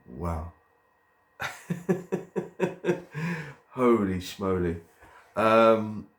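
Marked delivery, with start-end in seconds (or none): 1.4-3.5 high, drawn-out giggle; giggling laugh. Real, not acted. Vlogger amazed at using a whole box of track, giggling. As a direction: giggle at length, high-pitched.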